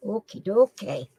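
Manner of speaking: ordinary delivery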